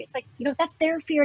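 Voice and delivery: ordinary talk, nothing marked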